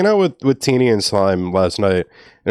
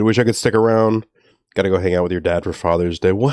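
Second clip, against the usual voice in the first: ordinary talk, nothing marked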